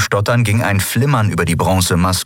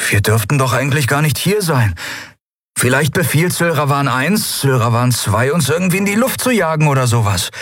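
1 nervöse hohe Stimme; 8 semitones higher than usual. Acted. Miko unsicher, hohe Stimme.

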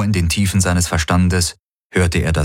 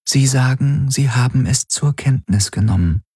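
higher-pitched go-between relaying a message; interpreter's voice; 6 semitones higher than usual. A narrator's reading. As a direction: relay a translation in a raised, even voice. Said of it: "sagte die Helix".

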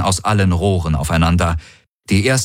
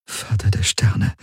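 unvoiced, stunned whisper; horrified whisper; 3 dB quieter than usual. A narrator's reading. Kirai at the sight of the battle, horrified whisper. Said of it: "flüsterte Kirai bei dem Anblick".

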